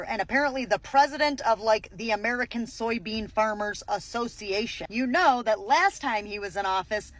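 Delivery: ordinary delivery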